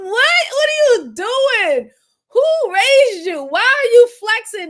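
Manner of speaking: high, loud, slow outraged questions; outraged shouting. Real, not acted. Shouting at the suspect who is flexing, slow and loud. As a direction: demand answers in disbelief.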